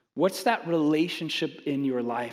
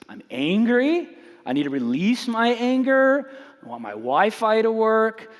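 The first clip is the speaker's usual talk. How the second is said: raised, louder mock-angry rant; 5 semitones higher, 4 dB louder than usual. Pastor mimics himself venting about bad Wi-Fi, mock angry. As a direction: puff up like a fed-up customer.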